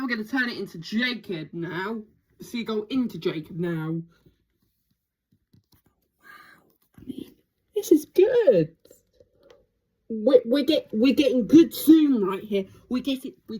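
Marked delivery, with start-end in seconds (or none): 6.2-7.3 awed whisper; soft whispered wow. Real, not acted. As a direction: breathe it out in wonder. Hushed awe at the birds.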